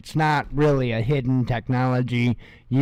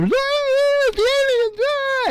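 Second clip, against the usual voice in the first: panicky prepper; slower than usual. Retold or imitated. Mocking prepper channels, squeaky high panicked voice. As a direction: shriek about the aliens like a panicked prepper. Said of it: "making fun of all the prepper channels".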